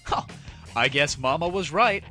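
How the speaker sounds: usual speaking voice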